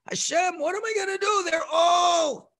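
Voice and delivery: high, loud pleading cry. Retold or imitated. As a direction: call out to God in distress. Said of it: "So Moshe cries out to Hashem".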